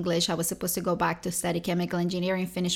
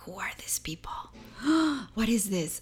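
panicked whisper. Retold or imitated. Caller startled by strangers answering, whispered with a gasp. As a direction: hush your alarm mid-call.

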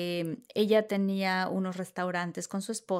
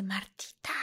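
unvoiced secret whisper; hushed urgent whisper; 2 semitones lower, 9 dB quieter, slower than usual. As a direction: whisper her name so nobody hears. Friend whispers celebrity is there.

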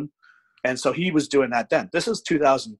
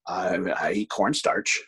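soft-spoken guy; 6 semitones lower, slower than usual. Retold or imitated. Imitating the big lifter answering his question, soft-spoken. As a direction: lower and slow, a mild imitation of a shy, confused lifter. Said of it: "very soft spoken".

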